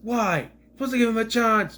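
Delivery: high, loud, anguished mock lament; David Tennant impression. Imagining the Doctor's speech over the dead Sontarans, hammed-up anguish. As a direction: ham up a grieving Doctor's outcry. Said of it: "And Dave Tennant".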